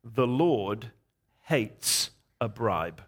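low, slow and deliberate divine pronouncement; voice of the Lord. Retold or imitated. Preacher relaying what the Lord told him aloud, low solemn voice. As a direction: weigh each word gravely, pitched low. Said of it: "the voice of the Lord said this".

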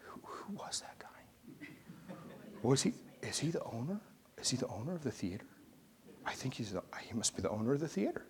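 put-on hushed, wondering kids' whisper; kids whispering. Kids ask each other who the man was, whispering. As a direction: whisper it like awed teenagers.

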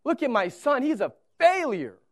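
hammed-up scorn at the stumbling son; mock disappointed father. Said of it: "what if I would have looked to my wife and said".

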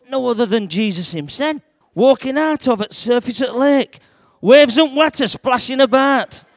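lightly voiced Yorkshire fisherman Peter, folksy storyteller. Peter telling children about the storm, Yorkshire fisherman voice. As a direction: tell it as a broad northern fisherman.

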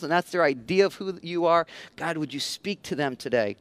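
pastor praying over his church, hushed and sincere; prayer. Real, not acted. Leads a prayer.

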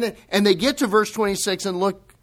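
ordinary delivery

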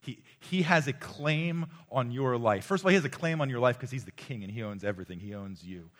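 loud, driving preacher's proclamation; preaching at full force. Acted. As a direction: press the claim hard on the crowd.

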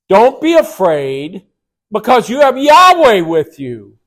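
exhorting the congregation at full force; forceful preaching. Acted. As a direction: proclaim it with full conviction.

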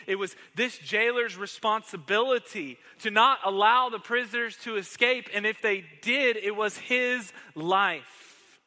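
fiery pulpit preaching; preaching at full force. Acted. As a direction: hammer the questions home to the congregation.

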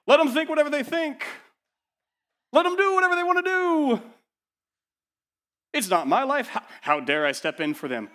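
sarcastic high-pitched indifference; mock careless parent. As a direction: play the parent who can't be bothered.

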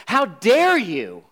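paraphrasing Jesus with mild indignation; indignant rebuke. Acted. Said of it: "What Jesus is saying here is".

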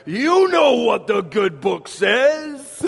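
light Yiddish-tinged Tevye voice, slow; a hint of Tevye's accent, drawn out. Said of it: "I almost hit the accent".

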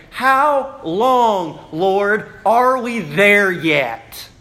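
slow, drawn-out loud cry to the Lord. Retold or imitated. Martyred saints crying to the Lord, loud slow plea. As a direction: call up to heaven, stretching it. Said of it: "they cry out with a loud voice".

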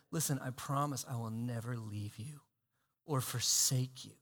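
Jesus tenderly promising never to leave; tender whisper-soft promise. Acted. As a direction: speak it close, hand on their shoulder. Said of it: "Jesus coming up to you and saying".

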